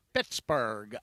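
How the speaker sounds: low "Pittsburgh" with a "barg" ending; local-style Pittsburgh. Retold or imitated. Host repeating the locals' version for a laugh, "Pittsburgh" as "Pittsbarg". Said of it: "as they like to say".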